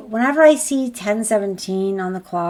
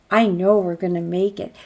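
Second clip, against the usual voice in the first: ordinary talk, nothing marked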